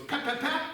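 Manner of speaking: high sharp "pap pap pap" shots; imitated gunshots. Everybody fires once at the goose, high mock shots. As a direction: crack out three high shots. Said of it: "and it goes".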